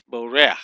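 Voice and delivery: borech said as two syllables, bo-rech; correct two-syllable borech. Shown as a demonstration. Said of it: "just two syllable word here instead of boreach".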